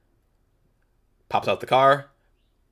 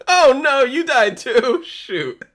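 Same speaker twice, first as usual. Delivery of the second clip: laughing; 7 dB louder, much slower than usual. Real, not acted. Laughing at teammate dying.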